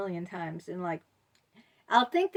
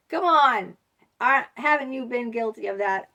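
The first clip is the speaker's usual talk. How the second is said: ordinary talk, nothing marked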